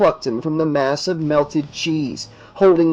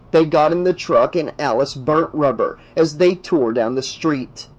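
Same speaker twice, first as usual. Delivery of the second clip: ordinary delivery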